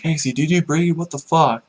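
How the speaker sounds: mock freak-out. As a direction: squawk it like a panicked scammer.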